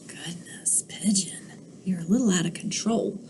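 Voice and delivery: soft whisper to the listeners; hushed storyteller aside. Acted. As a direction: lean in and whisper a secret comment.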